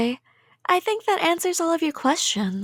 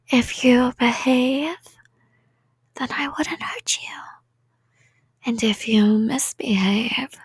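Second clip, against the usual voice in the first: whisper. Acted. Yandere explaining punishment.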